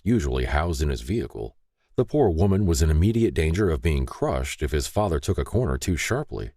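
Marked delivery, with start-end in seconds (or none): none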